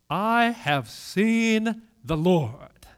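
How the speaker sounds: very slow, weighted declaration; awed announcement. Acted. Mary tells the disciples what she saw, slow and solemn. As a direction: draw out each word of the news.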